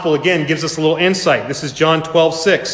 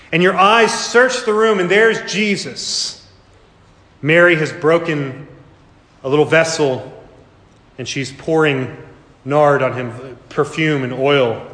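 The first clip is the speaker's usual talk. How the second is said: ordinary talk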